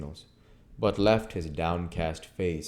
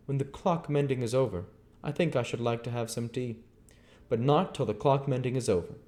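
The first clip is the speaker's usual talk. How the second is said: higher, curt stranger; stranger's voice; 7 semitones higher than usual. Acted. Stranger answering Henfrey, higher curt voice. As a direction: play the aloof stranger, pitched up.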